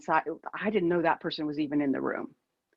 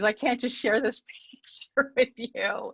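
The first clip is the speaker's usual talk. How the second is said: laughing; 4 semitones higher than usual. Real, not acted. Laughs at screen share trouble.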